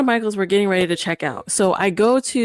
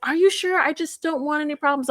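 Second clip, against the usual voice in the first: imitating the timid lady; 6 semitones higher than usual. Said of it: "And she's like".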